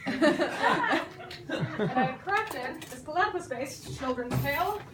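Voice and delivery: laughing through the words. Real, not acted. Presenting her story, laughing. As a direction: giggle as you announce it.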